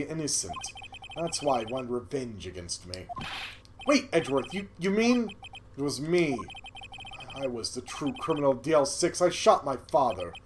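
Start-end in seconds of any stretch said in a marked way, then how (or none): none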